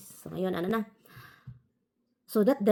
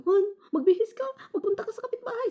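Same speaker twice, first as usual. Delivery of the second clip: much higher, loud urgent command; urgent order; 14 semitones higher, 4 dB louder than usual. Retold or imitated. Ordering her husband to go to the neighbor, urgent. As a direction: push him to hurry.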